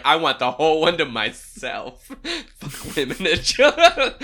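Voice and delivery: mock a selfish coward laughing. Voicing Jim on Titanic.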